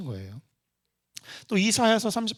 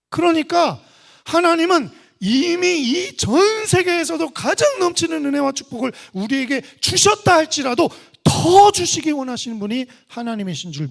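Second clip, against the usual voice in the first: loud, high-pitched impassioned sermon; shouted, emphatic pulpit delivery; full-force preaching; 11 semitones higher, 10 dB louder than usual. Real, not acted. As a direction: proclaim it to the whole congregation, voice soaring.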